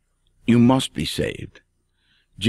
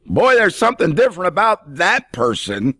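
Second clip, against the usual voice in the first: higher, impressed admiration; amazed remark; 9 semitones higher than usual. Pretend. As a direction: marvel at a changed person.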